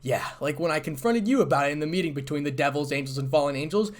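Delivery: loud, sarcastic retort, rising in pitch. A narrator's reading. Issei recalling the meeting to Azazel, sarcastically. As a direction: build to a pointed sarcastic jab. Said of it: "asked Issei sarcastically".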